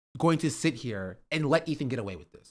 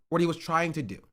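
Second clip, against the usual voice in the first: usual speaking voice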